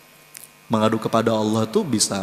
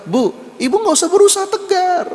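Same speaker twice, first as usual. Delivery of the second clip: much higher, louder, urgent reassurance; emphatic reply; 5 dB louder than usual. Retold or imitated. Preacher answering the widow, high and emphatic. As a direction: call out to her to stop forcing herself.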